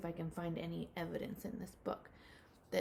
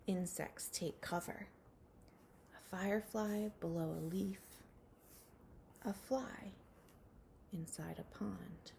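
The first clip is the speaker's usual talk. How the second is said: slow, hushed storybook reading with whispered lines; reading a picture book to children; 7 dB quieter than usual. Acted. Teacher reading a rain book to her class, hushed storybook voice. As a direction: read it gently to little ones, letting some lines fall to a whisper.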